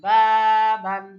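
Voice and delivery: teacher projecting বাবান for learners; slow loud model. Shown as a demonstration. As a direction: call বাবান out slowly to the class. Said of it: "কি উচ্চারণ?".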